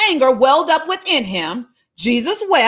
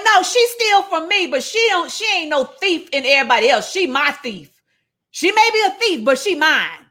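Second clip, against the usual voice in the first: indignant mother; 5 semitones higher than usual. Retold or imitated. Mother defending her thieving child to an accuser, indignant.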